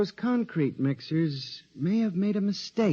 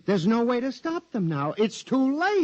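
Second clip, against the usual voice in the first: frantic despair; 7 semitones higher than usual. Acted. Patient warning the doctor of doom, frantic despair. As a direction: pitch leaps up, then drops to a hopeless murmur.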